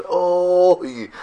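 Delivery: long drawn-out, level "oy"; demonstrated cry to God. Shown as a demonstration. Teacher demonstrating a cry aimed at God, drawn-out wail. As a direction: hold the "oy" as one sustained moan. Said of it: "צעקה זה מכוון לקדוש ברוך הוא".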